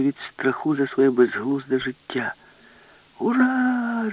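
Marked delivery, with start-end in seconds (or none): 3.2-4.1 much higher triumphant shout; cheer. Acted. Alice cheers while attacking the glass, high shout. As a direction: whoop with joy. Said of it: "закричала Аліса".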